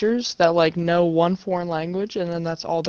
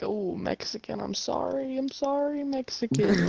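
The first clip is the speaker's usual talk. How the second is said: much softer, pleading mock apology; 5 semitones higher, 9 dB quieter than usual. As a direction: murmur a put-on sorry. Acts out mock apology to classmate.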